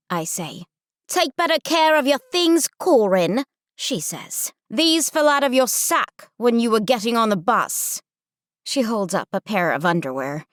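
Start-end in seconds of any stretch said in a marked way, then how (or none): 1.1-3.4 high, scolding British counselor; heavy British accent. Acted. Counselor scolding Corin on the bus, heavy British accent. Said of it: "she says in a heavy British accent". 4.7-8.0 higher-pitched British counselor; heavy British accent. Acted. Counselor returning fallen underwear, heavy British accent. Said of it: "she says in a heavy British accent".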